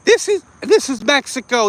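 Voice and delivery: high mocking voice; mock protester voice. Mocking protesters who say LA is Mexico, high and sneering. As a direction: parrot their claim with a sneer.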